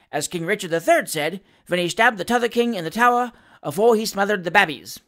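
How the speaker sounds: breezy, chatty manservant making introductions; lively Cockney patter, over-familiar with the magistrate; pleasantly familiar Cockney. Acted. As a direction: rattle it off with cheerful cheek. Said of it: "addressing the magistrate with pleasant familiarity".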